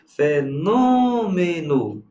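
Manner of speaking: slow "Fenômeno" with NÔ long and high; stress on the tonic syllable. Shown as a demonstration. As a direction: stretch NÔ in "Fenômeno". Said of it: "Proparoxítona".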